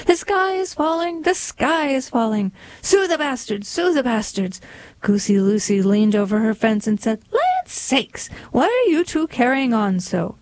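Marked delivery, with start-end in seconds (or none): 0.1-4.6 much higher, urgent mock cry; frantic alarm call. Two hens calling out to Goosey Lucy, higher frantic mock cry. Chicken Little and Henny Penny shouting alarm. 7.3-10.4 very high squeaky goose voice; a startled old goose shrieking; Goosey Lucy exclaiming. Acted. Goosey Lucy over her fence to the hens, very high startled goose voice.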